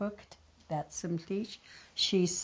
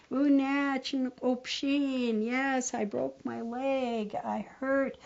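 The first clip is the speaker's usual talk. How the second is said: faking brother; 6 semitones higher, slower than usual. Acted. Brother pretends broken leg.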